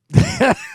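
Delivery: high burst of real laughter; big laugh. Real, not acted. Host bursting out laughing at a politician's quote.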